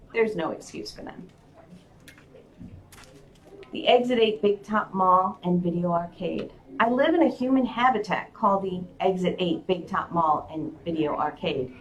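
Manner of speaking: ordinary delivery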